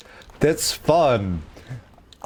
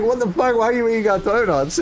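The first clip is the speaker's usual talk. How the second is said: mock outrage, pitched high and quick; indignant roommates; 8 semitones higher, faster than usual. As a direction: imitate housemates demanding an explanation. Roommates confront the donut eater.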